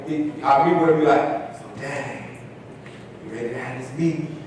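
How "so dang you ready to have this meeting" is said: hushed, breathy mentor voice; quiet imitation of an easygoing mentor; Mike's hushed voice. Mike voiced in retold meeting.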